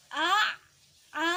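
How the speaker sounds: "a" as Arabic ain, held and pressed from the throat; ain bukan alif. Shown as a demonstration. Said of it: "alif dan ain harus berbeda". ("a" ditekan di tenggorokan seperti ain)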